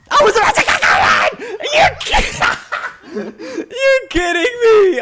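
high, loud yell cracking into laughter; outraged disbelief. Real, not acted. Player just beaten to the goal, laughing outraged shout.

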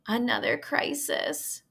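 put-on slow, groaning sigh of a girl fed up; weary groan. A narrator's reading. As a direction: drag it out like it's the hundredth mess. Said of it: "groaned Fern".

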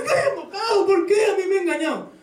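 hammed-up high sobbing complaint, half shouted; mock crying wail. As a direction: play the betrayed spouse crying out in mock despair. Preacher acts out betrayed spouse.

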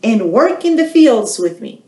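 storyteller voices the hen inviting her friends; hen asking friends. Acted. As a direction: call eagerly to her friends like a small hen. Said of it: "she asks her friends".

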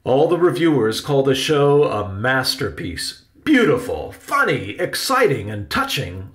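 theatrical uncle reciting his raves; proud Uncle Riley. Acted. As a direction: list the praise grandly, each word projected. Uncle boasts of reviews.

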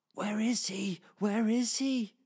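high anxious little bird voice; a small bird asking urgently, pitched high; worried sparrow. Acted. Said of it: "said Sparrow".

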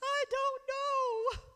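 put-on high, drawn-out teenage wail; exaggerated high whine through pretend tears; mock crying wail. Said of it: "I would just cry for days".

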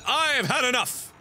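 high, emphatic declaration of being fed up; fed-up outburst. Pretend. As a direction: burst out as if at the end of your patience. Fed up, ending the episode.

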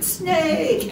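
high-pitched mock scream of snake. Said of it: "they screamed".

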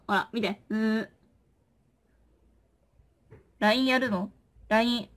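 ordinary talk, nothing marked

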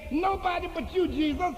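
high, ringing cry of praise; retold woman crying out in joy; joyful cry out. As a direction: call it out to the heavens. Woman cries out at graduation.

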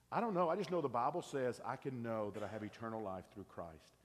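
ordinary talk

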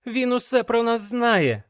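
high-pitched, alarmed exclamation; agitated cry on waking; exclaiming. A narrator's reading. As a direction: blurt it out, pitch way up. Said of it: "вигукнув я".

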